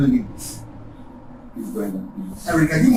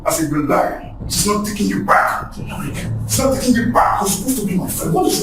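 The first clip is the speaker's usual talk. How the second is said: angry quarrel; 3 semitones lower, faster than usual. Acted. Men quarrelling over Brenda.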